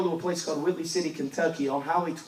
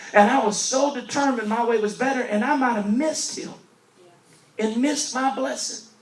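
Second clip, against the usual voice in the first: louder, higher preaching, slowing at the close; full-force preaching; 4 semitones higher, 6 dB louder than usual. Acted. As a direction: build the sermon to a peak. Preaching builds in intensity.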